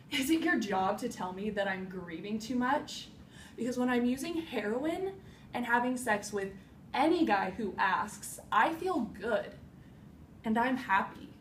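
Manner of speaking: loud, heated and pushed; defiant outburst. Acted. Grieving woman challenging someone who says she grieves too much, defiant outburst.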